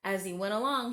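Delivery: ordinary delivery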